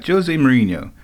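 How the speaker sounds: normal talking voice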